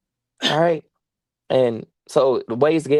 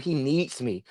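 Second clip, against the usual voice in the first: ordinary talk, nothing marked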